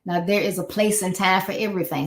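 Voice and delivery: ordinary talk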